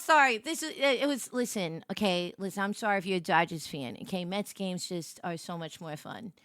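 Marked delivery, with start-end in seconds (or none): none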